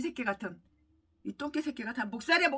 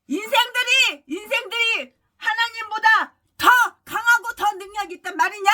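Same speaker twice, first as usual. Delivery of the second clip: higher, louder thundering pulpit question; preaching at full force; 7 semitones higher, 9 dB louder than usual. Acted. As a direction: thunder the challenge at the flock. Climactic sermon question. (온 힘을 다해 꾸짖듯 설교)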